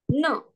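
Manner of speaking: no falling sharply from high; fourth tone. Shown as a demonstration. Tone mnemonic for students, fourth tone. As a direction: drop no briskly from a high start. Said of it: "dan nada empat".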